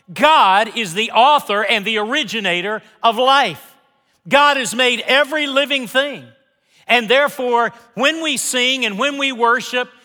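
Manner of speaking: high, loud preacher's climax; preaching at full force. Acted. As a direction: lift the congregation up.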